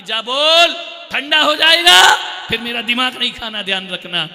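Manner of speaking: put-on mother shouting at the child; angry mother. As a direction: yell a threat about the food going cold. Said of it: "mummy कहती".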